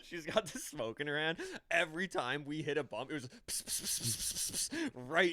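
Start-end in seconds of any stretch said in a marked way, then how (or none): 3.1-4.9 soft hissing pss sounds; mock sizzling pss. Friend retelling cigarette burns in the seat, soft mock hiss. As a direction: hiss them out like a cigarette burning.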